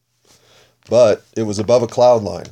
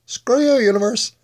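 squeaky mock voice; 13 semitones higher than usual. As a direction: squeak it out like a cartoon. Repeats the taunt at universe.

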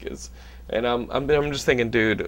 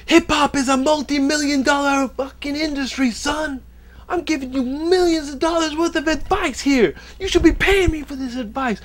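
much higher, louder yelling on the phone; Tracy Morgan yelling; 5 dB louder than usual. Retold or imitated. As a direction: rant at someone down the line like an irate celebrity. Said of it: "He's yelling, he's going".

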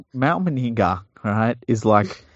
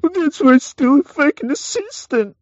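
much higher and louder, a scoffing put-on voice; mocking Anthony's retort; 5 dB louder than usual. Podcaster mocking the coach's jab at his assistant, squeaky. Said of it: "And Anthony goes".

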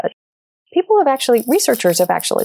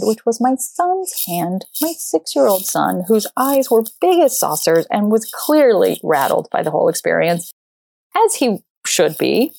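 ordinary talk, nothing marked

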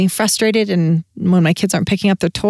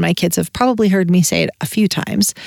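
ordinary talk, nothing marked

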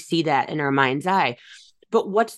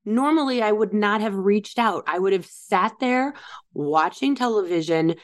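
ordinary talk

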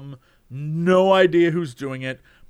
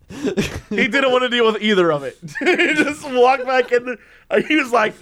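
amused, higher-pitched telling through real laughter; laughing while talking; 5 semitones higher than usual. Real, not acted. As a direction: let the laugh break into the words. Storyteller cracking up at own anecdote.

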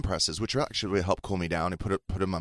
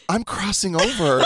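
mock melodramatic; 10 semitones higher, 3 dB louder than usual. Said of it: "I was like".

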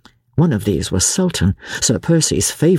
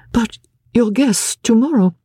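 higher, pleading lady; anxious wife; 10 semitones higher than usual. A narrator's reading. Wife protesting husband's sudden departure, anxious. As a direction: hold him back with worry.

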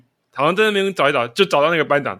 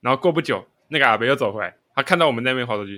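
ordinary delivery

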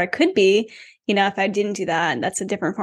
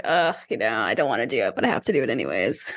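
much lower, softer, grumbling sulk; mock tantrum grumble; 9 semitones lower, 4 dB quieter than usual. Host mimicking her own tantrum about chores, low grumbling sulk. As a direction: grumble it like a grudging child. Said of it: "Just like temper tantrums".

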